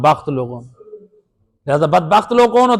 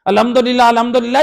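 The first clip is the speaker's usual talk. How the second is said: rushed mumble; 7 semitones higher than usual. Shown as a demonstration. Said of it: "یہ غلط ہے".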